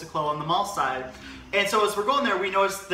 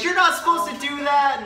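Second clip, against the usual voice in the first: higher, scolding mall employee; mock scolding; 8 semitones higher, 4 dB louder than usual. Clerk scolding teens at the mall gate, mild mock scolding. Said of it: "she started yelling at us".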